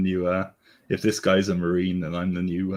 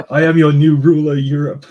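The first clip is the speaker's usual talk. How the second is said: loud, high proclamation from a throne; booming arrogant alien overlord; 7 semitones higher, 12 dB louder than usual. Pretend. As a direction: boom it out like a conquering overlord. Proclaims himself ruler of Europe.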